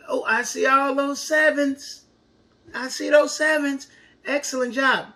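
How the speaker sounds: high, delighted praise. Real, not acted. Praising children.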